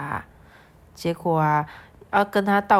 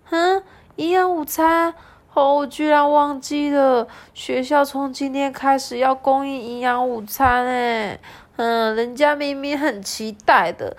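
put-on high, louder whiny little girl; Lala, sulky; 6 semitones higher, 3 dB louder than usual. Lala complaining to mom, high and whiny. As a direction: pout and blame her friend. Reading Lala's lines.

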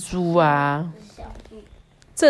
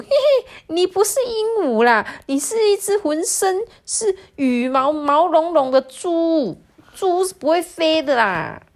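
very high, jeering monkey voice; shrieking monkey; 10 semitones higher, 3 dB louder than usual. A narrator's reading. Monkey exposing the pig's fake parrot act, high mocking cry. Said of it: "猴子就大叫说".

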